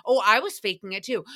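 ordinary delivery

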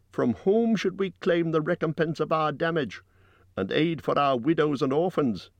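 high-pitched rhetorical questioning; loud and clear. A narrator's reading. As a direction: declaim each question high to the crowd. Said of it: "spoke very loud and clear".

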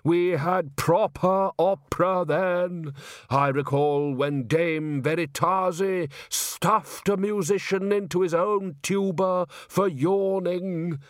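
slow, drawn-out nostalgic reverie; wistful old musician. Acted. Undershaft remembering the great sopranos, wistful. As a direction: linger fondly on each great name.

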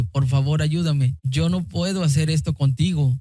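usual speaking voice

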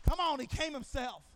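ordinary talk, nothing marked

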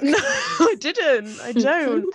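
laughing through the words, slow; giggling reply. Retold or imitated. Laughing while quoting.

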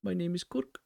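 high, badly faked Korg accent; terrible Korg accent. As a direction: try a hammy, high-pitched Korg accent. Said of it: "'cause that was terrible".

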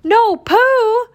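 high, loud shout; alarmed cry. A narrator's reading. Rabbit calling after Pooh, high alarmed shout. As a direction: shout a high-pitched warning. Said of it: "Rabbit cried".

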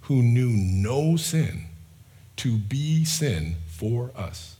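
very slow, every word spaced out; said slowly. Shown as a demonstration. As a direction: let each phrase land slowly. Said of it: "I'll say it slowly".

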